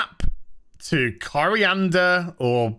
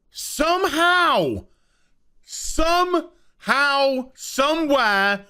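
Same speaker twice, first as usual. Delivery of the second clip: higher, slow, drawn-out somehow; exasperated disbelief; 8 semitones higher, much slower than usual. Real, not acted. Vlogger mocking the absurd twist, drawn-out incredulous somehow. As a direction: stretch it out in disbelief at the plot.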